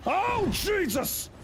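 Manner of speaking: high, drawn-out mock-Irish cry; Irish accent. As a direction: exclaim in a high put-on Irish voice. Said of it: "I can do an Irish accent".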